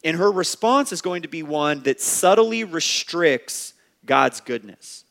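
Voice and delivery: normal talking voice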